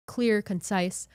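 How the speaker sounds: ordinary talk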